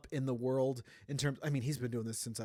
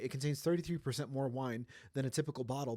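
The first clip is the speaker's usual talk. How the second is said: ordinary talk, nothing marked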